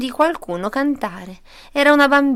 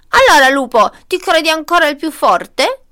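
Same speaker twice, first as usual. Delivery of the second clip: loud, raised challenge; scolding mother goat; 6 semitones higher, 8 dB louder than usual. A narrator's reading. Mother goat confronts the tied-up wolf, angry challenge. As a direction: confront him loudly, pitch climbing. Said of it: "disse mamma capra".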